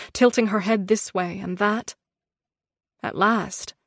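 usual speaking voice

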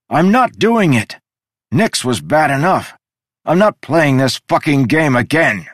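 loud, high defiant yelling; shouting upward. Acted. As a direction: yell defiance at the sky. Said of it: "shouted at the canvas ceiling".

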